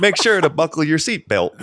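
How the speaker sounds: high, curved-up Minnesotan pilot; Minnesotan accent. Retold or imitated. Imitating the pilot addressing passengers, Minnesotan accent. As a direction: do a chipper-pitched Minnesotan announcement. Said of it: "Everything was curved up".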